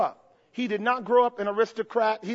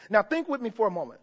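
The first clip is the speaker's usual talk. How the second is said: usual speaking voice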